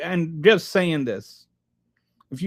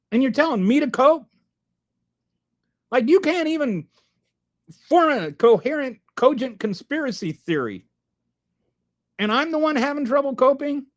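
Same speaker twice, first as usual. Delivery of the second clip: exasperated; 8 semitones higher than usual. Real, not acted. Exasperated rebuttal to chat troll.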